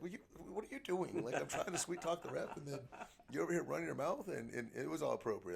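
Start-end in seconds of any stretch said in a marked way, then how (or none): 0.0-4.3 much higher, mock-exasperated coach scolding. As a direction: play the irritated head coach, pitched way up, half joking. Imitating exasperated coach to assistant.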